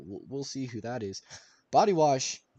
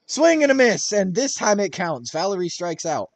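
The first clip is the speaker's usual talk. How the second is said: loud, high strikeout call; excited; 7 semitones higher, 10 dB louder than usual. Real, not acted.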